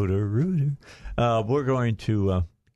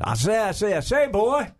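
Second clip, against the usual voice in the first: mock Southern colonel, pitched way up; Foghorn Leghorn; 10 semitones higher than usual. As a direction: ham up the blustery cartoon rooster. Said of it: "is akin to Foghorn Leghorn".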